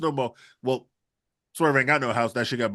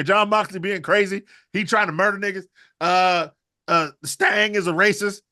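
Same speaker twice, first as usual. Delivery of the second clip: usual speaking voice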